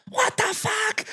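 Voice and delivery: freaked-out buddy. Retold or imitated. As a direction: hit it way up high like he's losing it. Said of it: "And he goes".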